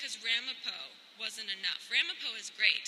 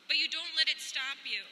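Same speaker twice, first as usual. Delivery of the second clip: ordinary delivery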